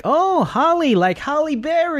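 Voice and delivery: very high, drawn-out mock realisation; delighted mishearing listener. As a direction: mimic Americans getting the name wrong, high and bright. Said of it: "they're like".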